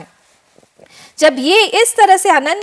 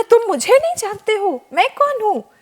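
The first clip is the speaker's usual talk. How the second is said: higher, proud king-of-heaven voice; boastful Indra; 5 semitones higher than usual. Acted. Indra boasting to the boy hermit, higher and proud. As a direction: announce yourself grandly as the king of heaven. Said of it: "मैं हूँ स्वर्ग का राजा इंद्र".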